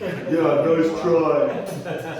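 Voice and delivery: loud, high mocking drill-sergeant retort; scoffing sergeant. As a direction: scoff at the recruit loudly.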